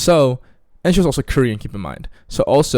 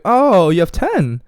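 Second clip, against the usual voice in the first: high, loud, drawn-out disbelief; slow mock astonishment, pitched way up; acted surprise; 8 semitones higher, 7 dB louder, much slower than usual. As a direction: replay being taken aback, slowly. Acting out his shocked reaction.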